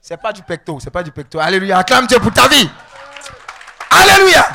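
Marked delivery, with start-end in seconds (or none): 1.4-4.4 very loud hallelujah shout; rally the congregation to cheer; building to a high, booming cry; rousing acclamation. Real, not acted. Calls congregation to acclaim God.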